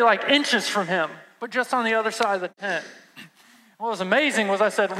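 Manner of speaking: ordinary talk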